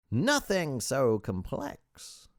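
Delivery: high, slow, showy humility; false modesty. A narrator's reading. Sage answering the visitor's guesses, high and falsely modest. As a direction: make a slow show of being modest. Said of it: "He was all false modesty".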